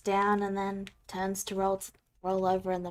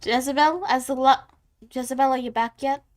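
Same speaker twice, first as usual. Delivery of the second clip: loud, raised call across the room; 4 semitones higher, 7 dB louder than usual. Acted. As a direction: call her name loudly. GM calling out to a character.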